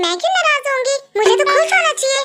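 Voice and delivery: high-pitched mock innocence; sarcastic denial. Mock denial of being angry.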